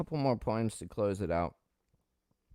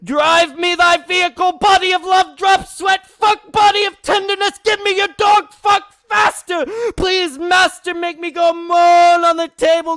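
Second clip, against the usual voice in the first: higher and louder, crying out in ecstatic pleas; building shout; 16 dB louder than usual. Acted. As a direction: let the pleas climb into shouts. Poem climax, rising cries.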